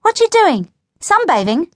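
high, bright-pitched, loud teasing questions; mocking high-pitched query; cheeky teasing. A narrator's reading. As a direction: tease a friend over the fence. Grinning girl teases over the fence.

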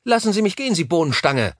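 put-on high-pitched, indignant little girl; hissy angry girl. A narrator's reading. Struggling girl to the man holding her, angry and high. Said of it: "fauchte sie".

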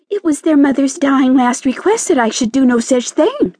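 high-pitched, offended protest; indignant. A narrator's reading. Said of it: "said the caterpillar indignantly".

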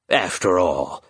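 eerie, cold phantom voice, coaxing; a ghostly bird tempting its prey; sinister phantom bird. Acted. Phantom bird tempting the grieving raven, eerie and cold.

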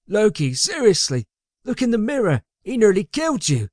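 high-pitched protesting henchman; high, incredulous underling; pleading sidekick. A narrator's reading. Magnus pleads with his master.

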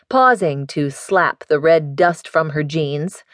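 ordinary delivery, nothing marked

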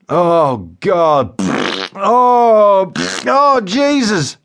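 high, loud, drawn-out moans of discomfort; Joe Windass groaning. A narrator's reading. Joe suffers bursts of flatulence on the phone, groaning loudly. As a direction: groan through each burst of wind.

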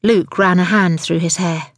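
ordinary delivery

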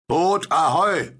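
long, high, loud hail; hailing shout. A narrator's reading. Will hails a distant boat through cupped hands, shouting. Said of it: "die Hände an den Mund hielt und rief".

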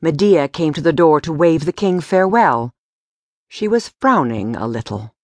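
usual speaking voice

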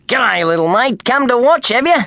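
friendly workman. A narrator's reading. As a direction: greet the boy like a friendly bloke. Kenny voiced in story.

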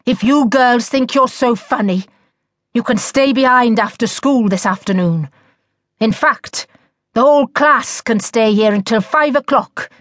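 cross teacher handing out punishment; shouted. A narrator's reading. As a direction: scold the whole class. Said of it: "he shouted".

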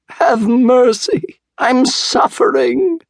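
very high, drawn-out pleading wail; hammed-up wail of a suffering fox. A narrator's reading. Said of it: "the fox wailed".

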